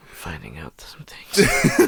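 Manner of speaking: slow low whisper. Acted. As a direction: hush it out slowly. Ominous aside.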